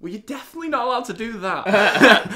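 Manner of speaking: high, loud mock indignation; indignant retort. Punched clubber telling off the bouncer, mock indignant. Retold witty remark after being punched.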